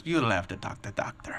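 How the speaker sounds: whispered mock Russian accent; flat Russian tech brushing him off in a whisper. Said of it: "Boris's accent".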